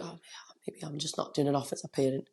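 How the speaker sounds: whispered inner thought, barely voiced; whispered doubt. Parent whispering a self-doubting thought to herself. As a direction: breathe the doubt out as a secret.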